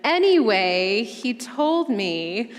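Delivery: slow, trailing, trying to move on; awkward change of subject. Retold or imitated. Ruth dodging Naomi's marriage talk, awkward and slow. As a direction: brush it aside and keep talking. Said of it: "Ruth is like".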